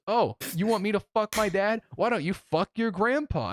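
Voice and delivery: high, indignant retort; retold yell at modest volume; mock angry comeback. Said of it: "we scream at each other".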